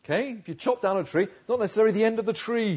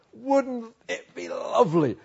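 high, slow, drawn-out longing; yearning wish; 5 semitones higher than usual. Acted. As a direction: stretch it out high, savouring the dream. Repeats the wish, higher and drawn out.

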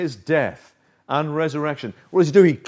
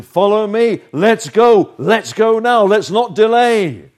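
louder, pressing call to go now; urgent invitation; 5 semitones higher, 5 dB louder than usual. Acted. Jesus urging the listener to follow now, loud and pressing. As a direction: urge them to come right away. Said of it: "There was the invitation".

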